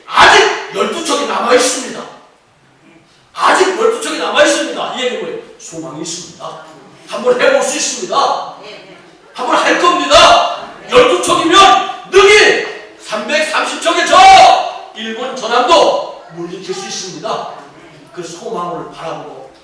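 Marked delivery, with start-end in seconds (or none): 0.1-4.9 lifted high and loud, full of hope; ringing declaration. Shown as a demonstration. Preacher voicing Admiral Yi's defiant vow, loud and high. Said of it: "근데 뭐라 했습니까?". 7.1-17.5 rousing shout. Acted. Preacher rousing the congregation.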